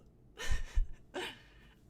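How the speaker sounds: a loud, high, airy burst of laughter. Real, not acted. Storyteller laughing loudly about feeling like jello. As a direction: crack up loudly at the memory.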